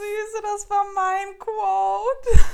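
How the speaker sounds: hoch, laut und gedehnt; ungläubig herausplatzen; überrascht laut. Real, not acted. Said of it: "sein Zitat war gleich". (lauter überraschter Aufschrei)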